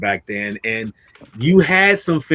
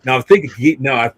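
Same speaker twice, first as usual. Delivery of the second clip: usual speaking voice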